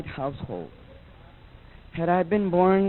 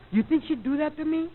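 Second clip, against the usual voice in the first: ordinary delivery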